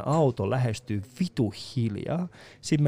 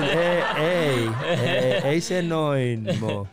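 mock protest; much slower than usual. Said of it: "Ja mä olisin".

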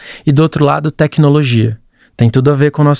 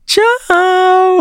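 very high, long-stretched singsong goodbye; imitated grandma's bye. Grandson mimics grandma signing off video, high drawn-out tchau. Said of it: "que ela não fala assim normalmente".

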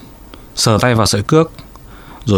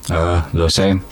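smug; 5 semitones lower, 4 dB quieter than usual. A narrator's reading. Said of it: "ông Văn ngạo nghễ nói".

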